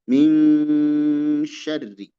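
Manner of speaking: "Min syarri" with a very long humming ng; held ikhfa. Shown as a demonstration. Teacher showing the held sound, "Min syarri" with prolonged ng. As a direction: hang on "Min" as "miiing", then drop into "syarri".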